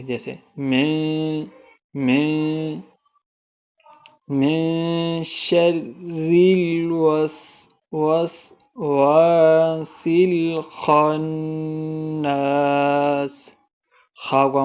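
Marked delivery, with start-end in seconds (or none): 0.6-2.7 میں hummed through the nose; nasal ikhfa. Shown as a demonstration. Teacher showing ikhfa to students, nasal hum. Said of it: "ناک میں پڑھیں گے". 4.3-13.5 slow, melodic tajweed recitation; Quran recitation. Shown as a demonstration. Teacher reciting the opening for students, slow and melodic.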